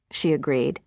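ordinary delivery, nothing marked

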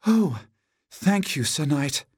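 high-pitched damsel voice, gushing; crying out gratefully. A narrator's reading. Damsel thanking the knight who saved her, high grateful cry. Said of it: "cried the damsel".

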